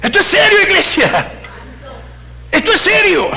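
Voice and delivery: high, insistent pulpit cry; forceful preaching. Real, not acted. Preacher telling church this is serious, forceful preaching.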